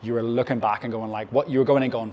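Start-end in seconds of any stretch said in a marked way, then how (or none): none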